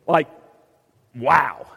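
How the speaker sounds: hammed-up rebuke with a scoff; mock scolding. Preacher voicing what Jesus never told Peter, mock scolding. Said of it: "At no point do we see Jesus going".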